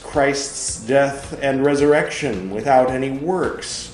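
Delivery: loud, emphatic reading of Luther's words; forceful sermon reading. Acted. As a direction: argue it out from the pulpit. Reads Luther's text aloud.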